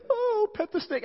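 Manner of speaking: put-on very high, cooing little-kid voice; eager child voice. Son coming up to pet a snake, imitated high child voice. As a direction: put on a delighted child's squeal.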